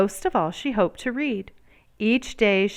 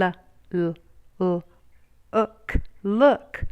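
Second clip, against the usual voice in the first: slow sounding out letter by letter; sounding out slowly; slower than usual. A narrator's reading. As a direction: stretch each sound like a beginner reader. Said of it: "Sounding out letters and words".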